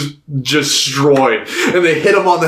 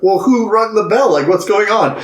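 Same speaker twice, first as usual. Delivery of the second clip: higher puzzled asking; mock confused samurai; 6 semitones higher than usual. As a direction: ask around baffled.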